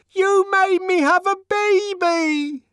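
high, loud, slow screamed accusation. Acted. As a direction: scream it over the laughter. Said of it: "she screamed above the uproar".

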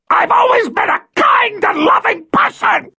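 high shrieking cry of rage. Acted. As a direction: wail it, furious and desperate. Violet shrieking self-defense.

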